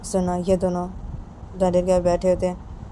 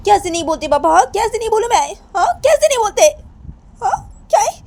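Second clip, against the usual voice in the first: much higher, louder wailing protest; crying outburst; 11 semitones higher, 7 dB louder than usual. Acted. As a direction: break into a crying tantrum. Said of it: "तो सुनैना अपने पापा को बोलती".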